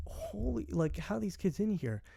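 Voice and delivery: a hushed mock astonishment; amazed. Retelling his amazed inner thought.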